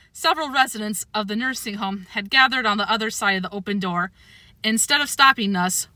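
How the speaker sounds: usual speaking voice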